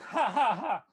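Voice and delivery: loud, high acted villain cackle; villainous laugh. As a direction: let out a big theatrical evil laugh. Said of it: "he starts laughing".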